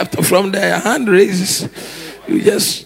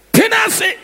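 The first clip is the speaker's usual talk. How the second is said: sharp high call. Acted. Preacher calling out the word to his congregation, high shout. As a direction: fling it out like a summons.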